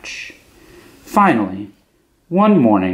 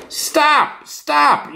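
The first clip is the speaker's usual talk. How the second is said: yelled stop. A narrator's reading.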